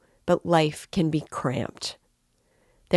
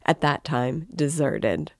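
plain reading voice

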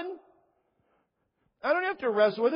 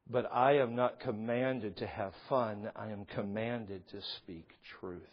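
sincere hush; 10 semitones lower, 4 dB quieter than usual. Real, not acted. Pastor telling the congregation the sad fact in a soft, earnest hush. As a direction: drop it down to a heartfelt hush. Solemn sincere turn after joking.

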